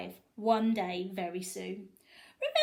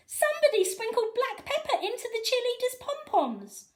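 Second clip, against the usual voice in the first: high, loud accusing cry; fan calling out; 14 semitones higher, 5 dB louder than usual. A narrator's reading. Fan in the stands accusing someone, high and loud. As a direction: announce it to the whole stadium.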